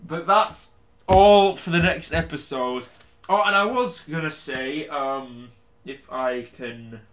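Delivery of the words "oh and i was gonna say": ordinary delivery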